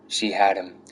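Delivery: ordinary delivery, nothing marked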